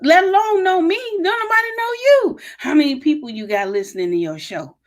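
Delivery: roast them like a stand-up comic; comedian voice. Retold or imitated. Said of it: "I'm saying this in my comedian voice".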